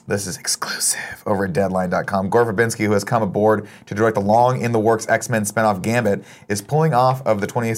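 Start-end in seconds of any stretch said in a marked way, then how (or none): 0.1-2.3 breathy secret whisper. Acted. Host whispering the exclusive source to the chat, hushed. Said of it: "whispered aside".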